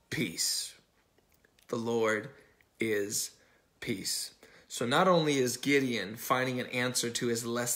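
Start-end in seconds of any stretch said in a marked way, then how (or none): 0.1-0.7 "peace" breathed out as a low whisper; the word "peace" whispered low. Acted. Preacher lands key word. 1.7-4.3 preacher lets the phrase sink in, slow, whispered end; slow with pauses. Acted. Preacher repeats key phrase.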